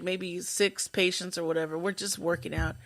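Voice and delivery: usual speaking voice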